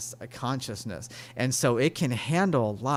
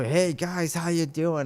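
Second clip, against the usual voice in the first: put-on higher, mumbling drunk greeting; drunk guy; 5 semitones higher than usual. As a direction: play the tipsy stranger, a touch slurred. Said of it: "just kinda mumbling and stumbling".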